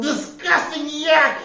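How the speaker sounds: very high-pitched outraged storybook cry; hammed-up captain's fury; angry yell. A narrator's reading. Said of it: "The captain yelled".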